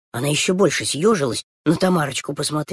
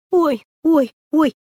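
fussing wail; 10 semitones higher, 5 dB louder, slower than usual. Acted. Fussing girl wailing.

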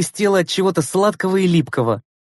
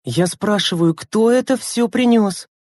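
stern, chilly demand; icy tone; 6 semitones higher, slower than usual. A narrator's reading. Lena questioning the children, icy. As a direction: press the question coldly, higher at the end. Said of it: "ледяным тоном проговорила Лена".

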